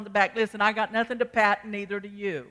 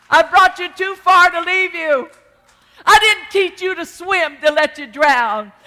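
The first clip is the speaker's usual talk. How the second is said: builds to a full preaching shout, much louder and higher; rising preached shout; 6 semitones higher, 11 dB louder than usual. Acted. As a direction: proclaim it like a revival promise. Preaching wisdom's promise, building.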